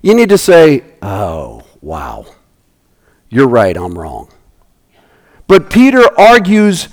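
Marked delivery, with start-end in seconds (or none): 1.0-4.3 much softer and lower, awed; hushed, awed confession; humble admission. Shown as a demonstration. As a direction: admit it quietly, humbled.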